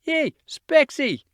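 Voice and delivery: high, drawn-out call; yelling out. A narrator's reading. Boy hailing his friend outside, a long high yell. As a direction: sing it out slowly across the yard. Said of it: "he yelled from the kitchen window".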